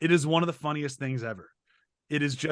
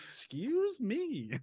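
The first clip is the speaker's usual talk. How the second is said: high, drawn-out, soft retort; mock indignant reply; 9 semitones higher, 9 dB quieter, much slower than usual. Voicing Star answering the screamed order, mock affronted. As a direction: play her affronted, slow and high. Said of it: "She's like".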